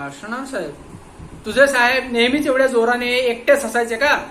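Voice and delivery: loud, high sceptical questions; stern loud grilling. Acted. Inspector cross-examining the watchman, loud and sceptical. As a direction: push him, disbelieving.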